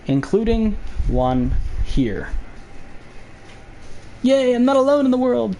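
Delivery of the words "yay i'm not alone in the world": excited. Real, not acted. Joyful outburst.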